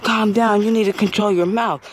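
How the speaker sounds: high, loud mock scolding lady. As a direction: scold a cursing kid. Voicing the lady scolding him.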